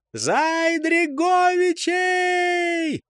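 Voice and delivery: very high, long-drawn yell; war cry. A narrator's reading. Charging enemy yells to finish off the squad, war cry. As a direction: stretch the cry out across the field.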